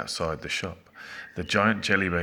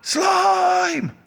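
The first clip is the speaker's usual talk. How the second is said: long, very high full shout; desperate summoning call; 11 dB louder than usual. A narrator's reading. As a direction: yell it with the vowel drawn out. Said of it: "the boy shouted again".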